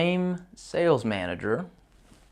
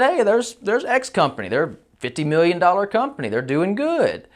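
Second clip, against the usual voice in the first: fishing for gossip; 7 semitones higher than usual. Retold or imitated. Speaker baiting receptionist for gossip.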